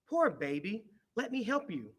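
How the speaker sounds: tender. A narrator's reading. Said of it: "said Little Hippo".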